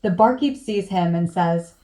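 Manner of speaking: ordinary delivery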